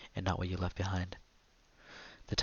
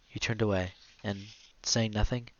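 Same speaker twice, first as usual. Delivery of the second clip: ordinary delivery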